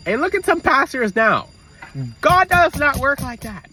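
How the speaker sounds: high, loud, slow preaching; fervent sermon voice. Real, not acted. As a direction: proclaim it to the congregation. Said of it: "preaching about pastors".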